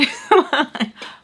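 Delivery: high, loud burst of laughter; gleeful laugh. Real, not acted. Teller laughing at her ex's message, gleeful laugh. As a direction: crack up after the taunt. Laughs at the man being mad.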